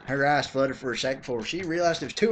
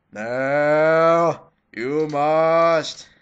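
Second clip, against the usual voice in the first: mock guru voice; 7 dB louder, much slower than usual. Answers herself as the Guru again.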